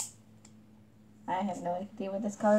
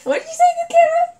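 much higher, louder and fast; excited disbelief; 11 dB louder, much faster than usual. Real, not acted. Hearing the guess, a fast excited squeal. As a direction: blurt it out in shocked amusement.

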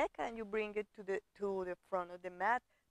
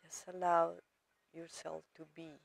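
soft, lowered, unhurried meditation voice; guided meditation; 3 semitones lower, 3 dB quieter than usual. Real, not acted. Yoga teacher guiding students to arrive to their body, calm meditation voice. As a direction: settle the listener gently, with pauses between phrases.

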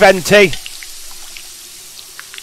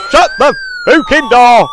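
loud, higher swearing yell; panicked yell; 12 semitones higher, 7 dB louder than usual. Real, not acted. Gamer screaming at the door in panic, real loud yell. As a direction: bellow the order in real panic. Monsters coming in, yelling.

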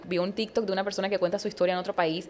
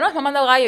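higher, louder "mamando gallo"; mock correction; 8 semitones higher, 8 dB louder, faster than usual. Retelling a back-and-forth with Venezuelans, insistent correction on "mamando gallo". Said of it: "ellos me entendían y yo".

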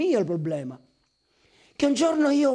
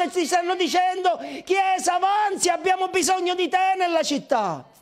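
preaching at full force; 5 semitones higher than usual. Acted. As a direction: fire the congregation up. Preacher rallies the church.

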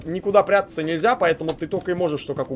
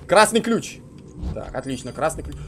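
usual speaking voice

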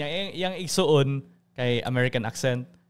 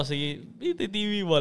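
higher, coaxing plea; pleading; 5 semitones higher than usual. Retold or imitated. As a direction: wheedle mom for the TV. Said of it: "everyday na ako gina".

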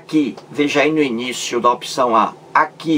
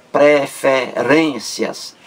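"preferências" slow, split pre, fe, then stressed "rência"; much slower than usual. Shown as a demonstration. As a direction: separate the first syllables of "preferências" and lean on "rên". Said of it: "ren, leva acento aí". ("preferências" pausado, com ênfase em "rên")

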